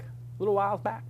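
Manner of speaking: a lightly drawled "A little whiles back" in a mock Southern US accent. Shown as a demonstration. Host joking to viewers in a mild Southern accent. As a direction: say it like a joking Southern good old boy. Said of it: "That's my southern accent".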